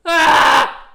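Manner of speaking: sudden loud high "Ah!"; shouted sneeze. Retold or imitated. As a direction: yell the sneeze out. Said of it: "your sneeze was like".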